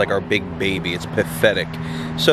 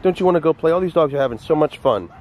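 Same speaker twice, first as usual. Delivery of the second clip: higher-pitched pleading to a pet; coaxing the dog; 6 semitones higher than usual. Real, not acted. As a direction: wheedle the dog in a raised voice.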